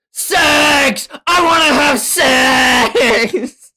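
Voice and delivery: high, slow shouted outburst; high shouted lines, drawn out slowly; shouting Denji. Acted. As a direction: blurt it out like an eager teen.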